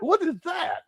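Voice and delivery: high laughing what is that; puzzled, squeaky laugh through it; amused confusion; baffled. Retold or imitated. His own confused reaction.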